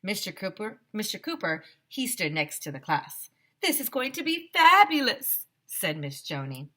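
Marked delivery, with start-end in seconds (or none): 3.6-5.5 high, loud, gushing photographer voice; hammed-up theatrical gusher. A narrator's reading. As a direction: gush over the class like a diva photographer. Flamboyant photographer Miss Joni.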